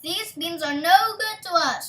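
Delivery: mother scolding Jack, loud and angry; angry cry. A narrator's reading. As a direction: cry it out at her son in anger. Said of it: "cried his mother angrily".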